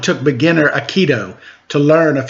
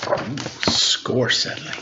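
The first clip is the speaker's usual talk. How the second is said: whispered aside; 3 semitones lower, 7 dB quieter than usual. Real, not acted. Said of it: "reader's aside".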